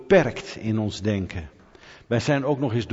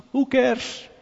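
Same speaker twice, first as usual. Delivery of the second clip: high-pitched, carefree shrug-off; dismissive; 13 semitones higher than usual. Acted. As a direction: toss it off in a much higher voice. Wuift voorgestelde kritiek speels weg. (hoog en luchtig wegwuivend)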